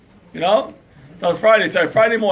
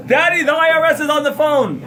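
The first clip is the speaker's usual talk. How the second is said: put-on higher, louder child calling out; 9 semitones higher, 5 dB louder than usual. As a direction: call out like a little kid. Child calls father.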